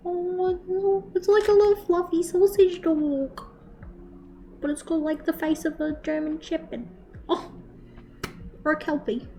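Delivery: high, soft cooing over a puppy. Real, not acted. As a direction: melt over the cute dog, voice up high.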